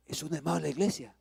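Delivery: soft, quick, quiet explanation; hushed repetition. Retold or imitated. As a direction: say it low and quickly. Esposa lo repite ya en calma. (en voz baja y rápida)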